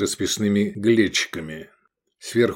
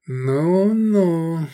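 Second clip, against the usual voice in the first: long, high drawl; drawn out; 8 semitones higher, 3 dB louder than usual. A narrator's reading. Customer answering the innkeeper's oath, drawn-out drawl. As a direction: stretch it out sceptically. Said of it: "протянул Искандер".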